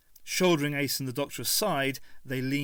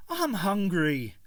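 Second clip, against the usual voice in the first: Ace hungry; 6 semitones higher, 4 dB louder, slower than usual. A narrator's reading. Ace voiced.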